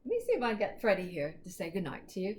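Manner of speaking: plain reading voice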